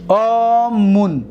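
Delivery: stretched out slowly; slow demonstration. Shown as a demonstration. Teacher to class naming the participle, slowly. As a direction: say it slowly so students catch each sound. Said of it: "اسم فاعلش".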